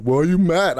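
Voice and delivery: put-on questioning wife; imitating wife. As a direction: mimic a wife asking, pitch lifted. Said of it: "And then she's like".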